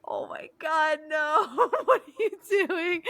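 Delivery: high-pitched laughing mock horror; appalled disbelief. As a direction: exclaim in squeaky, laughing dismay. Appalled reaction to mom, retold.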